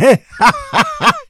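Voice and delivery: high, far-off gale of laughter; bursting laugh. Acted. R at Adam's shock, bursting laugh. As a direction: let the laugh explode out of him. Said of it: "R se nalil smíchem".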